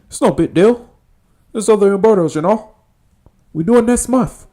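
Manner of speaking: high, loud, hammed-up gangster caricature; mock gangster griping on the phone; Tony from Scarface. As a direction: complain in a high, strained movie-gangster voice. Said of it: "funny about Scarface".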